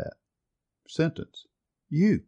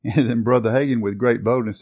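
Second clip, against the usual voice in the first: usual speaking voice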